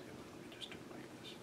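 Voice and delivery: breathy secret whisper to the audience; whispered aside. Acted. Magician confides to the audience in a whisper. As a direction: let them in on the secret, whispering.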